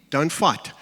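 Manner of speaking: ordinary delivery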